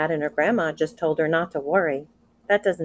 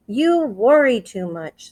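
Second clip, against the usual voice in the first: slower, louder mother voice; a mother chiding her worried child; lightly voiced fussing mother; mother's scolding; 6 semitones higher, 7 dB louder, slower than usual. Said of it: "said her mother".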